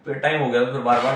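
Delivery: ordinary talk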